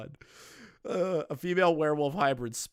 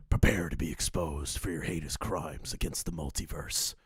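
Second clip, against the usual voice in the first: much lowered, ominous modulated voice; Mama Max voice modulator; 10 semitones lower, 3 dB quieter than usual. A narrator's reading. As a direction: drop deep as if through a voice changer. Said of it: "Mama Max uses voice modulator".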